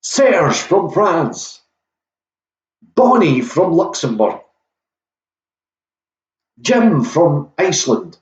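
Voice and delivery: high, announcer-style game show host; lightly hammed-up quiz host intro; game show host welcome. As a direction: welcome the players like a cheesy TV host.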